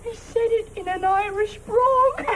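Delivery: high-pitched, helpless laughter through the words; laughing. Acted. Woman ending her tale of the outing, laughing. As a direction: laugh your way through the punchline.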